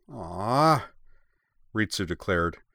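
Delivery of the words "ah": drawn-out "ah", higher, verging on a whine; frustrated whine. A narrator's reading. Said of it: "a sound of frustration that verged on a whine".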